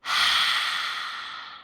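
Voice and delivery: long, voiceless exhale; airy sigh trailing away; deep sigh. Shown as a demonstration. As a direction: let all the breath out in a deep sigh. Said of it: "exhale it all out with a deep sigh".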